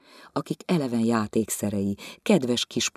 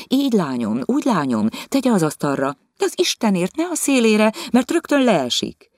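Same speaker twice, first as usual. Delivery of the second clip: nagging instructions building to a high, loud alarm; fussing over the maid, rising into a panicked cry; fussy lecturing mistress; 10 semitones higher, 6 dB louder than usual. Acted. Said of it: "Izgága jó indulattal leckésztette". (izgága, egyre hangosabb kioktatás)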